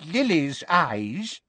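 high, very slow wheezy wizard. A narrator's reading. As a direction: stretch both words out in a wheeze. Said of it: "said a wheezy-voiced".

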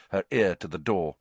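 plain reading voice

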